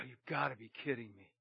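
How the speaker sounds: low, very soft groan of disbelief; disbelieving mutter. Retold or imitated. Glazier seeing the glass a foot short, muttering. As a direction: mutter it to yourself, stunned. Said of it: "I go".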